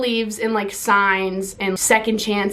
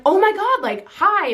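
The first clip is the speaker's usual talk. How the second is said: startled high greeting; 10 semitones higher than usual. Retold or imitated.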